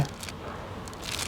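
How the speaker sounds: tearful. Acted. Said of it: "रो क्यों रही है?".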